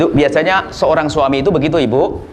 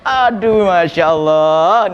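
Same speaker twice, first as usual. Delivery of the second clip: "Aduh masya Allah" drawn out very slowly in a sing-song; sleepy sing-song reply; 3 semitones higher, much slower than usual. Acted. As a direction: stretch it like a drowsy husband humming along. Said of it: "kayak nyanyi saja". (suami mengantuk, mendayu-dayu pelan)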